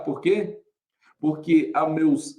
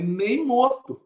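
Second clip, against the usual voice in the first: emphatic refusal; 7 semitones higher, much slower than usual. Retold or imitated. Retold refusal to teacher.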